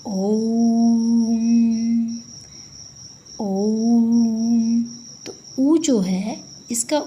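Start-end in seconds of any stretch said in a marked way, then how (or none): 0.1-4.8 ओम drawn out three beats long, steady pitch; prolonged Om. Shown as a demonstration. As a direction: hold ओम long like a chant. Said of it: "इसका उच्चारण जब हम करते हैं". (ओम को प्लुत स्वर में लंबा खींचें)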